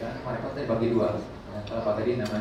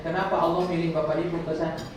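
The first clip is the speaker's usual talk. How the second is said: ordinary talk, nothing marked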